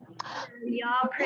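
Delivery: choked, whispered through real tears; tearful whisper. Real, not acted. Leader asking her team to pray, whispering through tears. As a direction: ask for prayers while crying softly. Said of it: "very emotional time for me".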